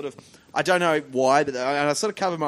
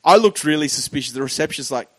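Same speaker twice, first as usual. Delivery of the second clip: ordinary delivery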